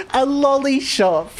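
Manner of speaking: high, drawn-out gleeful coo breaking into laughter; tickled delight. Real, not acted. Streamer delighted by a viewer's cute word, high amused coo. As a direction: savour the cute words, slow and high.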